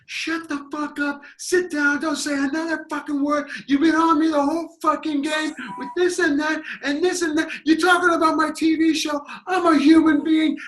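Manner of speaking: high, loud angry rant; imitated rage. Angry fan yelling at the heckler, high mock rage. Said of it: "he was just like".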